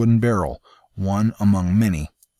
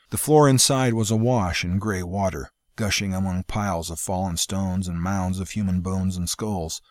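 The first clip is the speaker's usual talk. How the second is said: ordinary delivery, nothing marked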